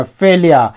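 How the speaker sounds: फेलियर as "fel-ya", falling, no final r; correct failure pronunciation. Shown as a demonstration. Teacher repeating the model for learners, फेलियर said "fel-ya". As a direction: repeat फेलियर with the r left silent. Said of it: "फेलियर बोलेंगे".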